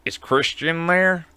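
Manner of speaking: slow, odd imitation of the caller; creepy caller. Scam caller asking for the host, slow creepy voice. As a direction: draw it out like a creepy stranger. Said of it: "he'll be like".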